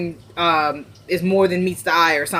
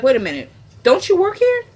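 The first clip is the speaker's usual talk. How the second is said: higher-pitched, pointed retold question; mock challenge; 10 semitones higher than usual. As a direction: call her out, pitch jumping up. Challenges her in retold quarrel.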